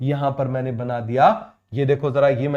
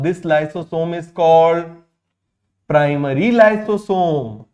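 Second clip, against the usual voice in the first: very slow, word by word, like dictating notes; deliberate teacher dictation pace; much slower than usual. Shown as a demonstration. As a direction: spell it out slowly for the class. Teacher dictating a term slowly.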